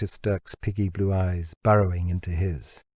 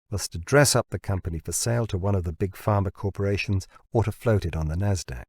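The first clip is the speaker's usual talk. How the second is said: ordinary delivery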